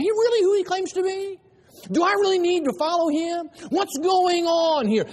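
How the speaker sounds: wondering listener. Pretend. Preacher voicing the crowd asking is he really the one.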